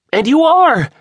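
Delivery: very high, loud excited declaration; exultant reveal; triumphant. A narrator's reading. James triumphant on the phone.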